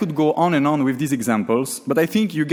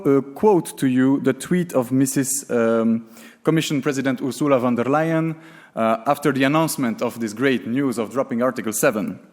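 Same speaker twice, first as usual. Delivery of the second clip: ordinary talk, nothing marked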